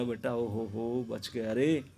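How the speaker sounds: soft and slow, relieved mother cradling her son; relieved tender sigh. Acted. Mother in her lap comforting son, soft slow relief. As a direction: breathe out the relief, softly and slowly.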